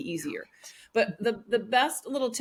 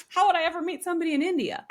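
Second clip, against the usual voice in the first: incredulous past self; 7 semitones higher, faster than usual. Retold or imitated.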